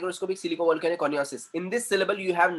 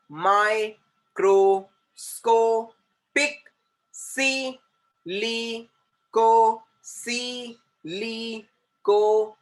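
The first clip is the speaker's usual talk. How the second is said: higher, very slow, each syllable held and separated; 4 semitones higher, 4 dB louder, much slower than usual. Shown as a demonstration. Teacher showing the sound changing, long word slowly split into syllables. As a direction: chant out the long word one sound at a time. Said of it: "The sound is changing".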